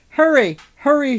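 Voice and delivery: high, loud repeated urging; urgent shout. A narrator's reading. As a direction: shout it twice to speed them on. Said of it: "shouted the head".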